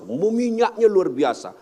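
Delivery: normal talking voice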